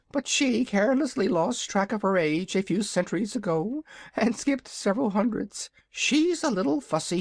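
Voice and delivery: high, proud little dragon voice; a boastful baby dragon, pitched high; young dragonette. Acted. Dragonette speaks to visitors.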